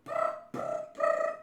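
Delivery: very high rolled "brr"; short high buzzing bursts; imitated trill. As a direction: trill like a whistle.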